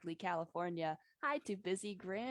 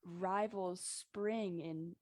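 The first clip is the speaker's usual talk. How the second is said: ordinary delivery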